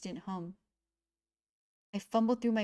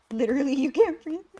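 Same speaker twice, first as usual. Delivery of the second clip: loud reaction; 5 semitones higher, 8 dB louder than usual. Real, not acted. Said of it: "reacting loudly to game".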